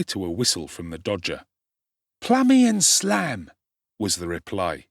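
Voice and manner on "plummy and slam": high, loud, slow call of the password. A narrator's reading. Dodger calling the password down the passage, loud. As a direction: sing out the watchword.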